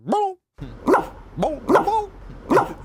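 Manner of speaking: high, loud imitated "Boop" barks; chicken-like barking. Imitating his dog Doogie barking, high chicken-like yaps. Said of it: "He barks like a chicken".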